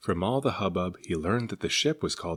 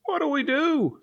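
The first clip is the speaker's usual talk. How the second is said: much higher, panicked passenger; frightened cry; 4 dB louder, slower than usual. Acted. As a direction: cry out in alarm. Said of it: "someone cried".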